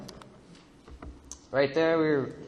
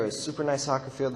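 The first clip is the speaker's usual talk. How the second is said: usual speaking voice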